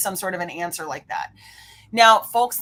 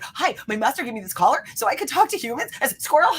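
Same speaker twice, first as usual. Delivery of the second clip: high, bouncy talking-dog voice; high-pitched, hammed-up doggy enthusiasm; excitable cartoon dog; 6 semitones higher than usual. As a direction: play an eager dog who gets suddenly distracted. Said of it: "that dog in the movie Up where he's like".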